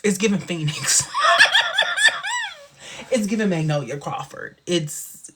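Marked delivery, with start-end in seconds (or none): 1.1-2.5 a loud, very high burst of laughter; big laugh. Real, not acted. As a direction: let out a huge squealing laugh. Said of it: "I don't know why I laugh like that".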